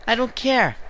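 high squeaky I don't care; high mock voice. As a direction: squeak it out mockingly.

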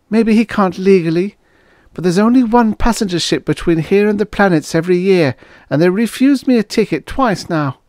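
put-on high-pitched, quick girl's voice; young woman confiding her plight. Waitress explains why she cannot leave Corby, urgent. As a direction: protest urgently in a high female voice.

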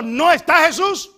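loud, slow, high cry of loss; anguished cry. Acted. Mary at the empty tomb, crying out loud. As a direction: wail that he is gone.

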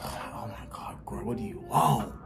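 whispered, fed-up aside; exasperated whisper. Retold or imitated. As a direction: breathe it out under the breath, annoyed. Exasperated reply to the manager.

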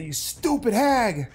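slow, drawn-out mild mock scorn; imitating his old slow sneer at the hag; retold mock insult. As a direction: drag out the insult like he used to. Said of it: "I would say".

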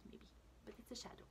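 fast trailing whisper; hurried hushed aside. Real, not acted. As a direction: rush it out in a whisper.

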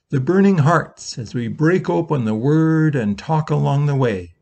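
usual speaking voice

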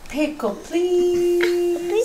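"Pickle, please" stretched very slowly; slow modeled words. Shown as a demonstration. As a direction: draw out "Pickle, please" like a teacher. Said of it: "modeling the words again".

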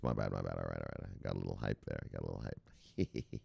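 low, very soft self-correction; quiet, deflated climb-down after the hype; sheepish backtrack. Real, not acted. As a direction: drop right down and mumble an apology. Backing off after getting hyped.

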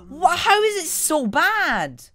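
high, loud disbelief; exasperated shout. Real, not acted. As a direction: yell in frustrated disbelief. Raging at the gun.

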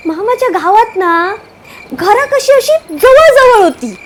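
usual speaking voice

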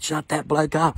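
annoyed inmates shouting at the singer; yelled complaint. Retold or imitated. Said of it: "They yelled out".

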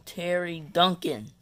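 ordinary talk

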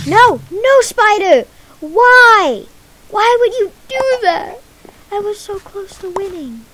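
loud, high protest of disbelief; dismayed outcry. Real, not acted. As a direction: wail at the spider for ruining it. Spider ruins the win.

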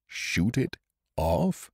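slow, musing echo of the words; slow repeat. A narrator's reading. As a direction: turn the words over slowly. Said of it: "he repeated".

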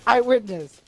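normal talking voice

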